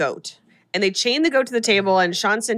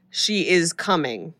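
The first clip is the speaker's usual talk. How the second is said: low, slow and drawn out; mock-eerie medium, lower and slower; ominous announcement; 5 semitones lower, slower than usual. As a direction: intone it slowly like a medium in a trance. Possessed medium's ominous announcement retold.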